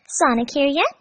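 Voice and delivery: put-on girlish hopeful lift; hopeful Amy. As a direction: ask it full of hope. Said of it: "she asked hopefully".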